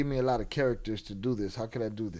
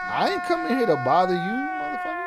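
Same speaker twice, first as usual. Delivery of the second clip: mock angry yell; 10 semitones higher than usual. Imitating the offended man yelling back in the bathroom, angry mock yell. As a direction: snap at him like an offended man. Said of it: "they all mad and butthurt".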